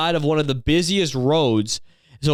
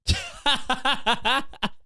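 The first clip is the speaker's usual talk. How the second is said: high burst of laughter; 9 semitones higher, 5 dB quieter than usual. Real, not acted. Laughs at mom's remark.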